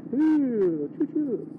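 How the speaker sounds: high playful choo choo like a train; train whistle. Pretend. Riding past, playfully tooting like a train. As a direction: toot like a little train.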